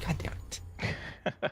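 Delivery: slow, whispered curse. Retold or imitated. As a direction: breathe the swear out slowly. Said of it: "I was like".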